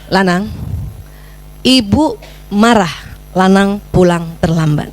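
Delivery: slow, low, spaced-out scolding; measured reprimand. Shown as a demonstration. Mother reprimanding son for coming home late, measured reprimand. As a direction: rebuke slowly, leaving room between phrases. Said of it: "tegur perilakunya".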